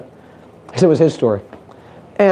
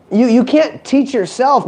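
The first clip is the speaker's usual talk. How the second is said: ordinary talk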